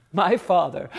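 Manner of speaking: usual speaking voice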